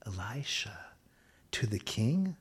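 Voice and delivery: unvoiced whisper of a name. Real, not acted. Preacher hushing the prophet's name, whispered. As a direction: breathe the name in disbelief. Incredulous aside about the king.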